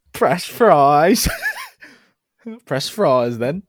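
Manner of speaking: quoting a sketch. Retold or imitated. Quoting the burger scene line.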